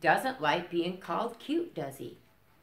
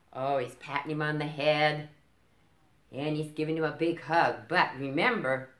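usual speaking voice

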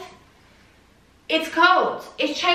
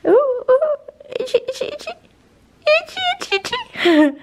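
much higher, sing-song itchy itchy; 14 semitones higher than usual. Real, not acted. Owner scratching her dog, high cooing baby talk. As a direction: coo while scratching the dog.

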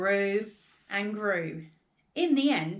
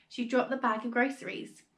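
usual speaking voice

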